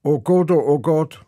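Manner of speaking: loud, high chanted recitation of sound poem; poem recital. Acted. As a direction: chant each oh Gott out loudly, playing with the vowels. Poet recites his sound poem.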